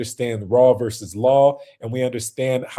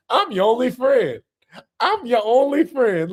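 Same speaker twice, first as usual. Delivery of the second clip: mock whiny imitation; 10 semitones higher, 4 dB louder than usual. Streamer mocking the drunk man's pleading, high whiny mock cry. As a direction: parody his pleading in a crying voice. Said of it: "Why he talking like this".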